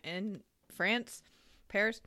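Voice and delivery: ordinary talk, nothing marked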